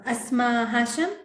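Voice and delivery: usual speaking voice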